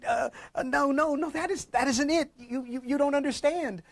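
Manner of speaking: high, halting sobbed denial; crying protest. Acted. A weeping man tells his wife she's got it wrong, high and broken. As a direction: choke the words out through tears. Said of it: "she heard him crying".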